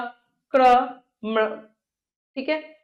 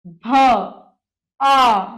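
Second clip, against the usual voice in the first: भ and आ stretched separately; slow phonetic breakdown; sounding out slowly; 6 dB louder than usual. Shown as a demonstration. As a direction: hold each sound, pause between. Teacher breaks word into sounds.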